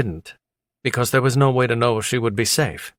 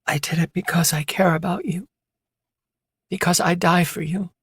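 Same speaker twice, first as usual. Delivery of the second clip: lightly voiced injured woman, weak and higher; 8 semitones higher than usual. A narrator's reading. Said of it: "She broke the silence with a weak voice".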